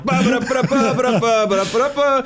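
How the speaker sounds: high sung da-da chase tune; sung chase music. Retold or imitated. Mimicking game music while driving.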